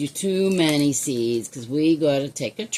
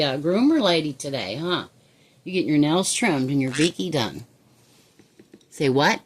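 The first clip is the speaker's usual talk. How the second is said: ordinary delivery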